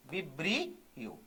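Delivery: "Vibrio" stretched out softly, low; slow dictation. Shown as a demonstration. Teacher repeats a term for students, "vibrio" slow and soft. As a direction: draw "vibrio" out slowly and softly, part by part.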